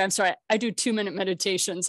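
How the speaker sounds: normal talking voice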